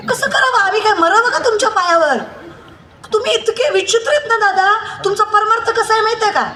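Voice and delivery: high-pitched, exhorting kirtan preacher; impassioned preaching. Acted. Preacher rebuking devotees over hollow Ganpati festival piety, high impassioned preaching. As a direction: keep the pitch up high and berate the congregation.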